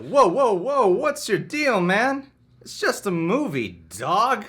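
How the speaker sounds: high, loud startled guard; shouting security guard. Acted. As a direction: back the customer off with a shout. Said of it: "I'll play Alfred".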